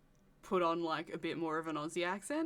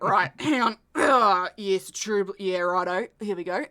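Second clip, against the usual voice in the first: put-on loud, forceful Aussie accent; booming Aussie accent warm-up; aggressively Australian accent; 2 semitones higher, 14 dB louder, slower than usual. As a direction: psych yourself into a big, broad Australian accent. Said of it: "aggressively Australian".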